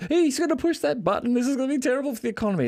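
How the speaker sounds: the public panicking over a leader's button, squealed high and fast; alarmed public. Retold or imitated.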